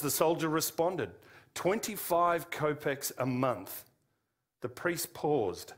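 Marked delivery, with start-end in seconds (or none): none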